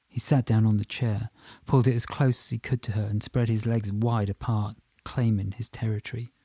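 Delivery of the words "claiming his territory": usual speaking voice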